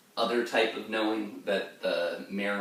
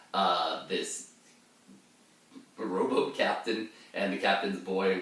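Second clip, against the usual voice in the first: usual speaking voice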